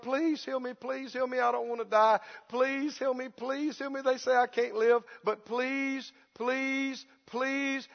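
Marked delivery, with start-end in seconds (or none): 0.0-4.0 soft, desperate mock plea; hushed, repeated pleading; begging prayer. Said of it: "they've been begging the Lord". 4.4-7.9 soft, repeated mock begging; imitated helpless prayer; groveling plea. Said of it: "they grovel and they fawn and they crouch".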